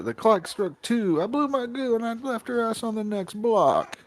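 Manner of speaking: high-pitched Brooklyn comic's rhyming delivery; Andrew Dice Clay. Acted. Friend adding another dirty rhyme in shock comic's style, Brooklyn impression. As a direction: recite it as a brash stage comic, stretching the rhyme. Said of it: "listen to Andrew Dice Clay".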